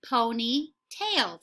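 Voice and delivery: Ponytail said very slowly; slow echo. Shown as a demonstration. Teacher echoing with young students, slowed right down. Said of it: "teacher says word slowly with class".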